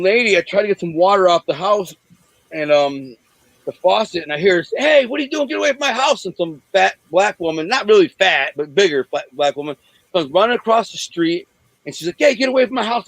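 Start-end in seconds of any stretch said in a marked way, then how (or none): 4.8-6.2 yelling lady. Retold or imitated. Homeowner yelling at intruder. 12.2-13.1 higher, raised shout, shooing him away; shouting woman. Retold or imitated. Woman running over, shouting.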